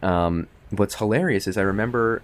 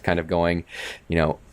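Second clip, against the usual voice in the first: ordinary talk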